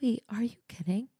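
whispered in disbelief; stunned whisper. Real, not acted. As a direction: breathe it out, moved. Hearing partner's sweet list.

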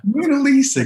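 high, loud burst of delight; surprised exclamation. Real, not acted. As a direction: blurt the name out, thrilled. Delighted recognition of a painting.

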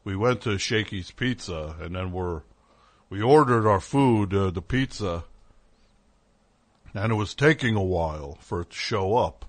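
lightly voiced low grumbler; complaining podcast host. Host retells waiting for pizza, mock low complaining voice. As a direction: imitate a host recounting a petty gripe. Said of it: "they're just like".

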